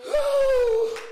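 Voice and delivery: high, loud "woo"; exultant whoop. Real, not acted. Preacher caught up in praise, exultant whoop. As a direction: let out a big praise whoop.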